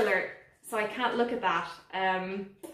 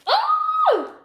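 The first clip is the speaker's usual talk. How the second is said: very high, loud squeal; delighted squeal; 7 dB louder than usual. Real, not acted. Admiring the new philodendron, squealing. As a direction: burst out in a piercing happy squeal.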